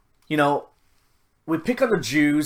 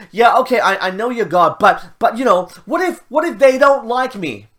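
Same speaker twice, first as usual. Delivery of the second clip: raised, louder voice, stammering objections; hammed-up worried protest, high and halting; protesting Moses; 8 semitones higher, 8 dB louder than usual. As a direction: imitate someone flustered and making excuses. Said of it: "And Moses was like".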